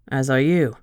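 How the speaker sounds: man coldly accusing the woman who returned from the dead; hard, accusing. A narrator's reading. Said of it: "His tone was hard, accusing".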